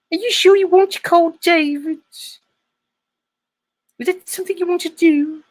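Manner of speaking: very high, hammed-up female English accent; squeaky My Fair Lady parody, English accent; mock English wife. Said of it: "only female English accent I know".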